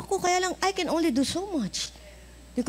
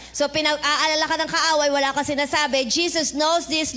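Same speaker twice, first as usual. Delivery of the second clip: ordinary talk, nothing marked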